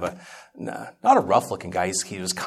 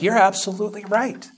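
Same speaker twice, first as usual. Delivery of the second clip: much higher and louder, fervent; emphatic agreement; 13 semitones higher, 4 dB louder than usual. Retold or imitated. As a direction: declare it with conviction. Retold agreement, now emphatic.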